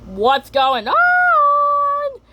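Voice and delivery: very high, slow squeal; Dima's squeaky voice. Retold or imitated. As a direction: squeak it out in cartoon alarm. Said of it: "Dima says".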